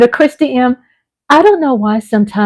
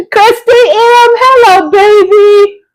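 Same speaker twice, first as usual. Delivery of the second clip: high, loud and slow, near tears; gushing greeting; 11 semitones higher, 5 dB louder, slower than usual. Real, not acted. Mother gushes a slow tearful hello to her daughter.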